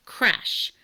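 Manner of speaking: usual speaking voice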